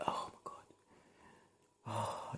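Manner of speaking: breathy whispered oh my God, drawn out slowly; whispered disbelief. Real, not acted. Guard vents about confiscated knives, whispered disbelief. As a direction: let it out under your breath in disbelief. Exasperated over confiscated items.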